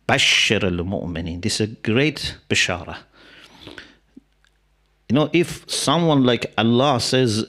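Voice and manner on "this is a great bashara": ordinary delivery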